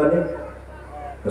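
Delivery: a long, breathless whispered نہیں. Acted. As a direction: barely voice the denial. Soldier's hushed denial at night. (سرگوشی میں نہیں)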